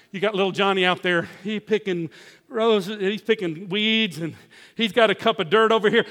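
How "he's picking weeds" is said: usual speaking voice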